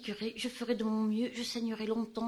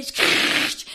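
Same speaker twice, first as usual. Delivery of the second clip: loud, slow, toneless blurt; the panic breaks through; sudden hissed outburst; 10 dB louder than usual. Acted. Outburst breaking a calm speech.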